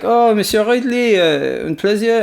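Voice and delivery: put-on French accent. French advisor answering Ridley, hammed-up French accent. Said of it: "your man's like".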